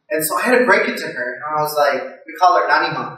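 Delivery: ordinary talk, nothing marked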